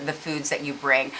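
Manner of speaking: normal talking voice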